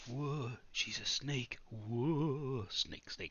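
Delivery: hissed, breathy snake whisper; mock snake whisper to scare the frog; snaky whisper. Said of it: "I'm just like".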